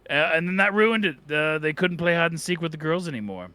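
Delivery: normal talking voice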